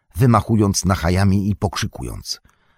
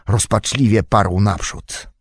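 usual speaking voice

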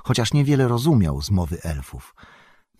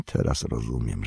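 low, soft, sobered voice; subdued regret; 9 semitones lower, 6 dB quieter than usual. A narrator's reading. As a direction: say it quietly, sinking with regret. Bilbo grasps burden, regrets. (cicho i nisko, ze smutkiem)

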